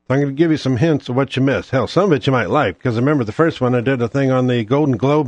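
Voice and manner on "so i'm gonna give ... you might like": ordinary talk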